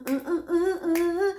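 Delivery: high, slow rhythmic uh sounds; vocal beat made of uhs; beatboxing grunts. Shown as a demonstration. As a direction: mirror dance beat noises. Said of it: "I dance in front of the mirror".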